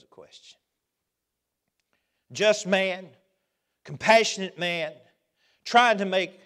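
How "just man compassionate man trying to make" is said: loud, raised preacher voice; fervent sermon delivery; preaching. Acted. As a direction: drive it home from the pulpit. Preaching at full force.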